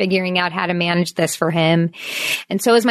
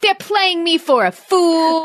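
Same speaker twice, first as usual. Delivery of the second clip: put-on slow, higher melodramatic kid line; dramatic kid; 7 semitones higher, slower than usual. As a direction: ham up the child's borrowed line. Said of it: "throws this out".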